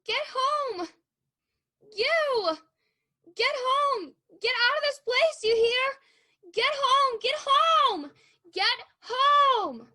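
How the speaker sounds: frantic yelling. Acted. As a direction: drive the child off with panicked shouts. Girl yelling at small child.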